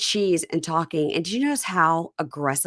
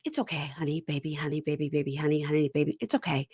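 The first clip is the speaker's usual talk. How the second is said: soft, lower mock consoling; soothing; 3 semitones lower, 6 dB quieter, faster than usual. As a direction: murmur pet names over and over to calm her. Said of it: "he's talking to her".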